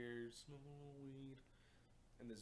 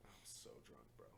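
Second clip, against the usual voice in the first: put-on soft drunk whisper; 10 semitones lower, 10 dB quieter than usual. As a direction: mumble like you're wasted. Drunk aside.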